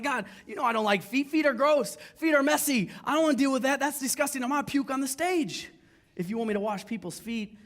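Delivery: high, loud comic whine to God; mock whining. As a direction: complain like a kid who won't do chores. Whining protest to God, retold.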